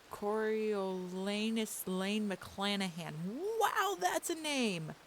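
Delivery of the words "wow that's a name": amazed. Real, not acted. Reacting to long name.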